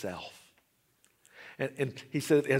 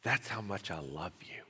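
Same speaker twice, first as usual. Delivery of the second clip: tender voice of God; 7 semitones lower, slower than usual. Acted. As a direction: speak gently as a loving father. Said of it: "God spoke to him and said".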